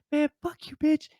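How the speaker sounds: mock him cursing her out; angry neighbor. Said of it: "he's like".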